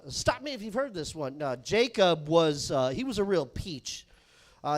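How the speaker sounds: ordinary delivery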